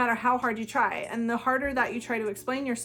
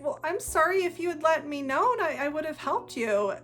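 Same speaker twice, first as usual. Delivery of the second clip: higher-pitched, placating apology; acted apology; 5 semitones higher than usual. Partner explaining to an upset narcissist, apologetic and pleading. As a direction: plead your case, mildly helpless.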